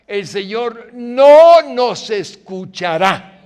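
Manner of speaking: slow, loud pulpit preaching; preacher hammering the point home; fiery preaching. Acted. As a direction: thunder each phrase at the congregation. Preaching at full force.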